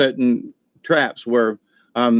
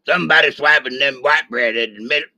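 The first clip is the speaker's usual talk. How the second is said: imitating the Sarge; 2 semitones higher than usual. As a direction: do the Sarge grumbling about his bread. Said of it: "He talked like that for real".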